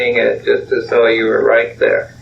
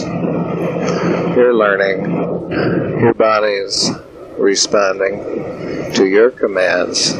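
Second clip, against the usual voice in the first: lowered voice, unhurried phrases with long pauses; guided relaxation; 3 semitones lower, 3 dB quieter than usual. Acted. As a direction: instruct the body to relax, measured and low. Closes tape with relaxation instruction.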